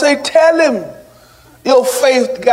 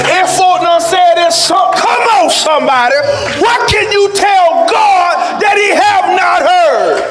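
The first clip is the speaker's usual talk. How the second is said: raised, ringing sermon delivery; full-force preaching; 7 semitones higher than usual. Real, not acted. Preacher tells the church how hard forgiving is, full-force preaching. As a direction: preach it high and forceful to the congregation.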